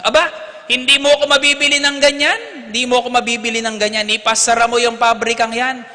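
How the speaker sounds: high, loud outraged priest; indignant refusal. Retold or imitated. Priest refusing the owner's bribe, outraged and loud. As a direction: throw the offer back at him.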